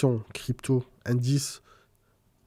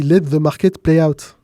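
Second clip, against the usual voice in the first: put-on slow, loud English quote in a French accent; English words said the French way, louder and slower; 3 semitones higher, 7 dB louder, slower than usual. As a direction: quote the American friend slowly with a French accent. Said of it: "Je dis avec mon accent français".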